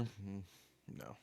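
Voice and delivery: usual speaking voice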